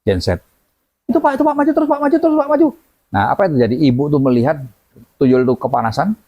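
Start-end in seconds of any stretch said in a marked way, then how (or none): 1.0-2.7 high-pitched, excited urging; eager urging. Retold or imitated. Mother eagerly urging the father forward, high excited calls.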